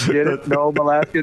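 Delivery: ordinary delivery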